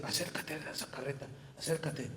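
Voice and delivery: soft, breathy, unearthly call; hushed voice of the Spirit. Acted. The Holy Spirit softly urging Felipe to approach the carriage, hushed. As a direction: murmur it like an inner voice.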